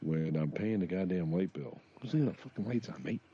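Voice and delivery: ordinary talk, nothing marked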